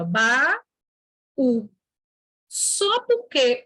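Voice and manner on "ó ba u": "ba, u" as two separate beats with a pause; split into syllables. Shown as a demonstration. Teacher splitting baú for the class, separate beats. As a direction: break "baú" into ba and u. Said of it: "baú se separa".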